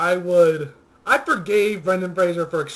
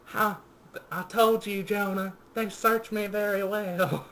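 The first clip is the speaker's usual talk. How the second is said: mock Southern accent; 6 semitones higher than usual. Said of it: "tries to do a Southern accent, but she fails".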